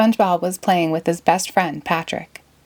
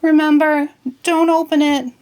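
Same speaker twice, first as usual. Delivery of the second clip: much higher, louder call; shout after him; 10 semitones higher, 4 dB louder than usual. Acted. SpongeBob calls after Patrick as he runs, loud and high. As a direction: call out after a friend running off. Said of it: "shouted after him".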